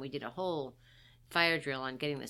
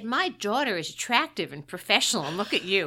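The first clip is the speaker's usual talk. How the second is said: loud, higher-pitched imitated put-down; mock disdain; 4 semitones higher, 9 dB louder than usual. Mother dismissing her unrecognized daughter, imitated disdain. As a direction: voice mother's scornful comparison, louder and higher.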